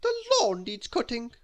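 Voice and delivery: high, loud bossy statement; overbearing high-pitched instruction; Little Miss Bossy. A narrator's reading. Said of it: "she said".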